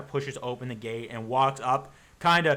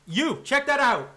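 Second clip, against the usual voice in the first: cultist shout; 11 semitones higher, 7 dB louder than usual. Acted. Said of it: "you hear one of the cultists go".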